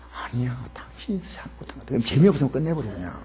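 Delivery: husband's reply in a near whisper. Retold or imitated. Husband answering his wife in a quarrel, whispered. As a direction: argue back under your breath. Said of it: "남편이 또 더 나서죠".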